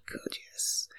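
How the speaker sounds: barely audible whispered approval; faint whisper. Acted. Woman murmuring to her lover, faint whisper. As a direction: breathe it almost silently.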